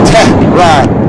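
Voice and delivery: Tehran loudly split into "TEH... rahn"; loud, pointed "TEH, RAHN"; mock correction. Shown as a demonstration.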